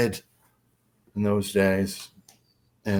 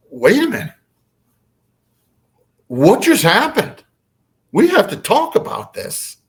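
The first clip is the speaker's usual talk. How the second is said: high, insistent retold plea; urgent, raised-pitch demand; urgent questioning; 10 semitones higher, 4 dB louder than usual. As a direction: stop them and demand answers, pitched up. Retold child demands answers after encounter.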